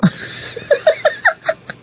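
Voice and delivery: high-pitched giggling laugh. Real, not acted. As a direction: let a high laugh burst out. Laughing after the joke.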